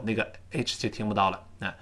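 normal talking voice

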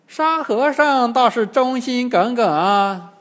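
high, slow, loud demon crone; old demoness, not angered. A narrator's reading. Old demoness commenting on loyal disciple, high and drawn out. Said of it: "金蝉老妖并不发火".

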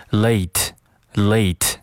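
slow "late" with the whole "ay-ee" glide; full diphthong. Shown as a demonstration. Teacher models for students, "late" with a complete long A. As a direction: say "late" fully, vowel drawn through to the t. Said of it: "一定要记得读完整了".

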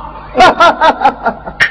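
a big theatrical laugh, falling away; booming guffaw trailing down; hearty stage laugh. Acted. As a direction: laugh it off as you leave. Neighbour laughs leaving.

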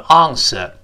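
"answer" with long ah and no final r; British pronunciation. Shown as a demonstration. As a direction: say "answer" as British "AHN-suh". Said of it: "英式发音读成".

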